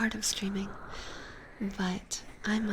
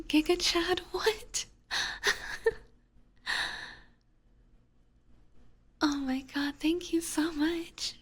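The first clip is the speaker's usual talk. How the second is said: much higher, squealing thanks that break out of the whisper; overjoyed at twenty gifted subs, gushing high; too excited; 9 semitones higher than usual. Real, not acted. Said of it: "I'm just too excited".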